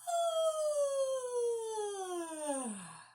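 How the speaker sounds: long yawn sliding down from high; big high yawn. Shown as a demonstration. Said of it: "and a big yawn".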